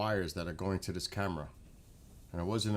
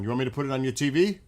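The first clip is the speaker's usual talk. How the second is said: a quick, louder call to Mom; 3 semitones higher, 6 dB louder, much faster than usual. Real, not acted. Son calls out fast to his mother about the TV. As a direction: rattle it off louder to the next room.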